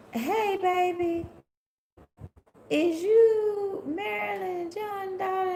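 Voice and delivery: put-on high, slow sing-song old lady greeting; nosy old lady. Old lady cooing at a child in the grocery store. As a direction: drawl it out like a nosy church elder.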